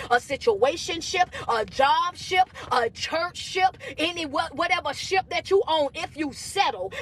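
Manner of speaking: emphatic preacher cadence with stretched phrases; motivational preaching. Real, not acted. Coach warning followers that settling means suffering, motivational preaching. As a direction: preach the warning in repeated waves.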